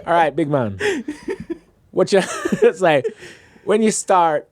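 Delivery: put-on playful Jamaican accent with laughter creeping in; imitated Jamaican accent. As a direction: mimic the Jamaican crew's accent. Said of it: "I'll stop doing the accent".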